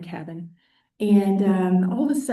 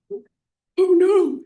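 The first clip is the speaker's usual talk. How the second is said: high, louder oh no; mock dismay; 9 semitones higher, 5 dB louder than usual. Teacher reliving calculus panic, hammed-up dismay. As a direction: groan it in comic alarm. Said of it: "I started, like, sweating".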